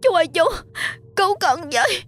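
very high, crying broken wail. A narrator's reading. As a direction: wail for help in agony. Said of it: "miệng kêu gào đứt quãng". (kêu gào đứt quãng)

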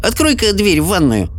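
put-on high, tart old woman. A narrator's reading. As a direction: grumble like a sharp old lady. Sophie voiced, irritated.

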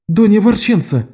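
high alarmed cry of a name. A narrator's reading. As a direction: call her name in alarm. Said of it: "вскричала София".

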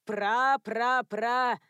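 "Пра-пра-пра" loud and slowly drawn out; emphatic correction. A narrator's reading. Mistress corrects gardener about his ancestor, "Пра-пра-пра" loud and drawn out. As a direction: stretch each "пра" at length, punched loud.

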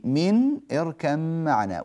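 "من اركب معنا" with the ba merged into a doubled "مـ"; slow Quran recitation demo of "اركب معنا"; assimilation demo. Shown as a demonstration. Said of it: "لاحظوا كلمة من".

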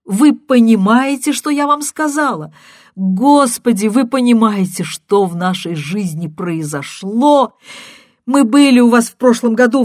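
loud, high and pressing; distraught woman pleading, shouting; frantic shouting. A narrator's reading. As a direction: hammer it in, frantic. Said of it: "крикнула она".

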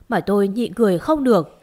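ordinary delivery

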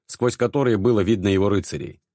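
ordinary delivery, nothing marked

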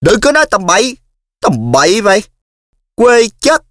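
high, loud angry shout. Acted. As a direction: snap back furiously. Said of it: "trợn mắt nhìn Sáu Chánh có vẻ giận dữ".

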